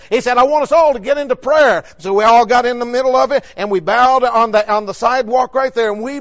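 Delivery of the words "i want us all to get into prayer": retold pastor's rallying call, higher and louder; raised preacher's call. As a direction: summon the whole group to prayer. Pastor calling group together to pray.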